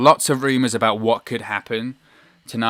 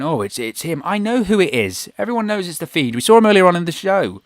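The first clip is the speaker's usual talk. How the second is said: higher, lightly voiced commentator overstating it; mocking over-the-top commentator; 7 semitones higher than usual. Vlogger mocking Corey Graves on commentary, higher and mocking. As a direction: parody the commentator stating the obvious. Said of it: "Corey Graves over the top of it going".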